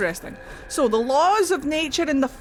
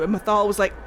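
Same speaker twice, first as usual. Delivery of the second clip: ordinary delivery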